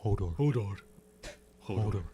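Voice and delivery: low, dim-witted giant Hodor voice; Hodor impression. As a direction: repeat it like a simple giant. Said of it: "That's pretty good".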